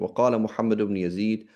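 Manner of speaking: usual speaking voice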